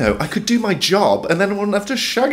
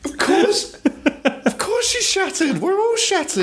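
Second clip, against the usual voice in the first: laughing; 13 semitones higher than usual. Real, not acted. High laughing agreement.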